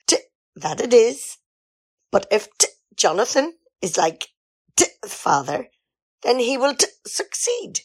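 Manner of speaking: stumbling over the written "t-" in a halting, lightly northern read; forced "t" dialect. Acted. Reading the writer's dialect lines to Jonathan, halting and forced. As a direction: reluctantly read awkward dialect lines, stuttering on each "t".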